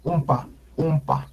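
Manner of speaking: Umpa with first vowel between O and U; intermediate O-U vowel. Shown as a demonstration. Teacher showing the Nahuatl O, between O and U. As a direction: round the lips for U in Umpa while saying O. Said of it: "es un sonido intermedio".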